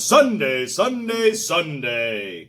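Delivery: high, stretched DJ call; radio voice. Shown as a demonstration. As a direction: sing it out like radio. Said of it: "He's got a radio voice".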